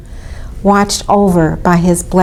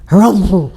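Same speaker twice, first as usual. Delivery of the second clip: loud rumbling camel harrumph; camel snort; 5 dB louder than usual. A narrator's reading. Humpy camels complaining, loud snort. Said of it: "snorted the humpy".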